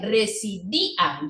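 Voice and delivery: residían drawn out slowly, each vowel apart; slow syllable stress. Shown as a demonstration. Teacher slowing down so students hear residían's vowels split. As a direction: spell out residían slowly for the class. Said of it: "hiato acentual".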